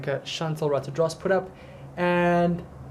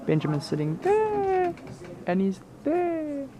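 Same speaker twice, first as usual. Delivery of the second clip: much higher, playful repeat; high excited; 12 semitones higher than usual. Real, not acted. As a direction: say it again, way up high.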